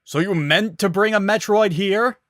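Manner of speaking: loud, high alarmed scream. Acted. Guard screaming down at Samus about the Metroid. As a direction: shriek it in disbelief. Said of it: "this time screaming".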